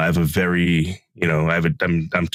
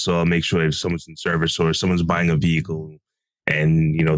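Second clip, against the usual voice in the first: usual speaking voice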